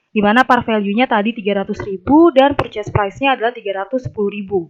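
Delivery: ordinary talk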